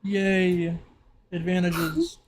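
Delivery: usual speaking voice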